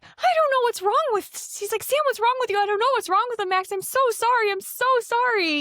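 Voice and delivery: very high, frantic apologetic mother; flustered mom. Retold or imitated. Lucy apologizing to Max for Sam, very high flustered. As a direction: squeak out embarrassed apologies.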